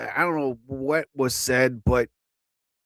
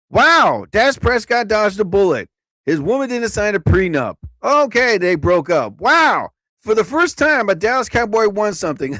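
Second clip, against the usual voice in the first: punchline louder and higher, selling it; louder, higher comic retake of the bit; 4 semitones higher, 5 dB louder than usual. Shown as a demonstration. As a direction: redo the joke big for the crowd. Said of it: "let's do that again".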